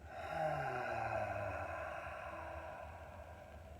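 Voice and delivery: long, slow audible sigh. Shown as a demonstration. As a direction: let a deep breath fall out in one long sigh. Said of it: "deeper, slower breaths and sighing them out".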